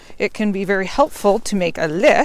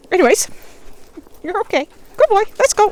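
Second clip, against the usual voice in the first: much higher and louder happy praise; 12 semitones higher, 4 dB louder, faster than usual. Real, not acted. Owner comforts and praises her puppy, high-pitched praise voice. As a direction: gush over the puppy.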